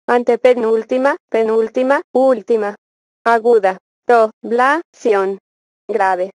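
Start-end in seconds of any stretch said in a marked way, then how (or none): 4.1-5.4 teacher breaking a word into syllables. Shown as a demonstration. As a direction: separate each syllable with a small gap. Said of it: "dividir en sílabas las palabras".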